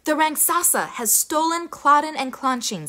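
a pig warning the mother; talking pig. A narrator's reading. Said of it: "said the pig".

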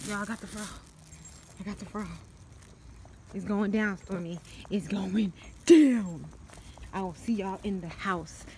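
whispering the prank plan; hushed so she can't hear. Real, not acted. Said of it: "hushed prank setup to camera".

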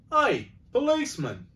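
high, loud hailing call; cabby pushing forward to hail the policeman; cockney cabby. Acted. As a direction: call out to the constable. Cabby shouts.

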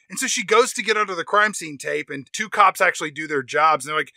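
ordinary talk

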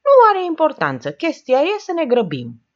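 high, loud, urgent push; impatient urging. A narrator's reading. Oprișan wants everyone to hurry, loud impatience. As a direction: brush it aside and hurry everyone. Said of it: "schimonosit de nerăbdare".

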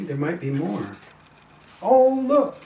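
usual speaking voice

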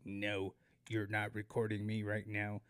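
flat, low robot voice. Retold or imitated. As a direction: speak it like a robot. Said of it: "I sounded like a robot".

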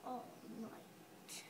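soft whispered ending. Acted. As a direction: whisper it like a lullaby.

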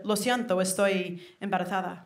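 put-on softly spoken, showy Castilian accent; mimicked Spanish accent. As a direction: play a would-be local in Castilian Spanish. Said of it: "being able to mimic an accent".

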